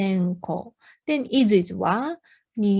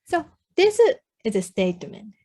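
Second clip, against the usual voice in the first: normal talking voice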